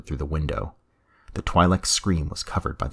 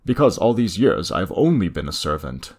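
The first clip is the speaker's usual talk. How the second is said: ordinary delivery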